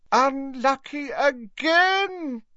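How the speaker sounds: very high, drawn-out lament; hammed-up booming disappointment; dramatic cry. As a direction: cry it out slowly and theatrically. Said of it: "cried Slughorn dramatically".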